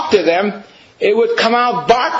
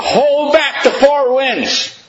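high, slow, proclaiming; scripture quote; 7 semitones higher, slower than usual. Acted. Preacher quoting Revelation to the congregation, high and slow. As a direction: ring out the verse.

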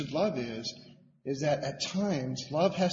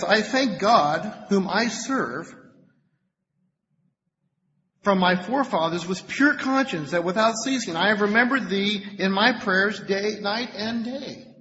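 higher, louder, drawn-out scripture reading; 5 semitones higher, 5 dB louder than usual. Acted. Preacher reads Paul's letter to the congregation, emphatic reading. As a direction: read the verse out with slow, raised emphasis.

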